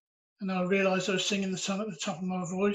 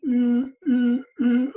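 loud, raised "ooh" hoots; imitated horn blasts; 4 semitones higher, 11 dB louder, much slower than usual. Churchgoer mimicking a woman's blasts on the horn, loud hoots. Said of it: "on the horn".

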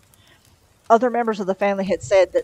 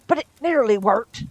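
mildly snappish retort, slightly higher; snapped; 4 semitones higher, 3 dB louder than usual. A narrator's reading. Crowley defending the old firm to the angel, snappish. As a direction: snap back with a touch of defensiveness. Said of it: "snapped Crowley".

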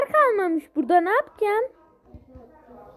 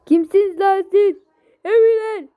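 higher, louder alarmed yell; startled shout; 5 semitones higher, 5 dB louder than usual. Real, not acted. Gamer yelling at sudden enemies, startled loud shout. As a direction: yell at the attackers.

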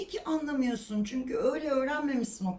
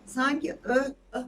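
normal talking voice

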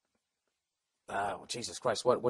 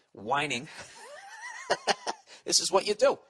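ordinary delivery

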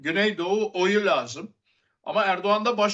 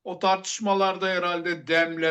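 ordinary talk

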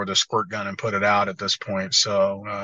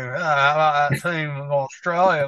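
put-on gloating Australian accent, higher; mock Aussie gloating; 4 semitones higher, slower than usual. Mocking an Australian friend gloating on weekly clips, in a higher Australian accent.

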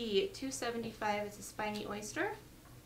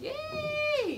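high, bright squeal of delight; excited cheer; 4 dB louder than usual. Real, not acted. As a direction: cheer like you just won. Cheering a sale on stream.

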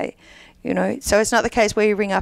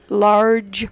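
large in a put-on American accent, hard r; a playful American accent, leaning on the r. Shown as a demonstration. Clowning for the hosts, a mock American large. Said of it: "Your American accent's very good".

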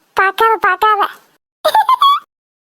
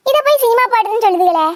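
ordinary delivery

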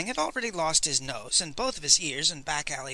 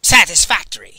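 loud, gruff grunt from a huge woman; grunted verdict; 12 dB louder than usual. A narrator's reading. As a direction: bark it out like inspecting cattle. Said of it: "she grunted".

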